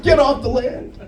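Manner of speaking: high, snooty scolding; uppity oil guy. Retold or imitated. Comic imitates an oil worker ordering him off the land, high snooty voice. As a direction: scold like a petty official. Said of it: "some uppity oil and gas guy got".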